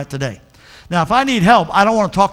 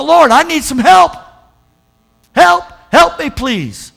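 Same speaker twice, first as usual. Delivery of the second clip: high, loud desperate plea; pitched-up begging prayer; pleading prayer; 9 semitones higher, 6 dB louder, slower than usual. Acted. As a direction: cry out to God for help. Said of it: "acted prayer for help".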